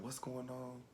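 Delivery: very soft, hushed question; weak whisper. Retold or imitated. Grandmother in pain asks grandchild, whispering. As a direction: barely voice it, like a frail grandmother. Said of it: "she was whispering".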